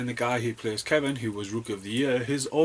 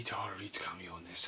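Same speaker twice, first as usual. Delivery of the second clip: whisper; 2 semitones lower, 9 dB quieter than usual. Acted. Whispered aside.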